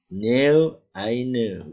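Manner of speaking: No said high and loud with an ou glide; English no diphthong. Shown as a demonstration. Teacher models No and I know. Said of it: "Sekarang saya tahu".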